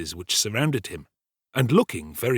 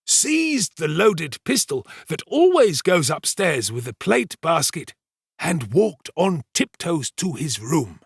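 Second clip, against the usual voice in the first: higher, deliberate pompous servant voice; self-important storyteller Giles; 8 semitones higher, 4 dB louder than usual. Acted. Servant retelling the night's alarm to the kitchen, pompous storytelling. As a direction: hold forth slowly, relishing the tale.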